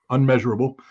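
normal talking voice

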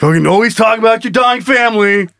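high, hammed-up griping; mock angry complaint. Man gripes at woman about her dying family, mock angry. Acted lovers' quarrel.

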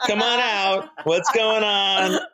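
high, drawn-out call to a hidden prankster. Pretend. Guest calls to a supposed prankster to come out, playful high holler.

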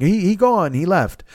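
lightly imitated broken English from a Japanese chef. Chef telling him the host left, in mild broken English. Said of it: "in a very broken, I don't wanna imitate their Japanese".

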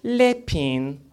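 put-on French pronunciation; mock French accent. Shown as a demonstration. Comic demonstrating French to the crowd, mock French accent. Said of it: "penis in French".

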